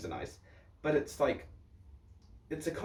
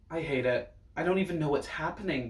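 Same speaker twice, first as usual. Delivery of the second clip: ordinary talk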